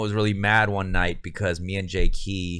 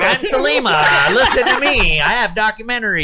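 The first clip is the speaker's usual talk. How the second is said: higher, louder, laughing mock voice; Selima impression; 12 semitones higher, 6 dB louder than usual. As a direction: ham up a self-important filmmaker. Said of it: "That's my impersonation of everybody".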